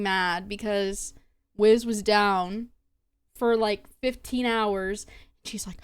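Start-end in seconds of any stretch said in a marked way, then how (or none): none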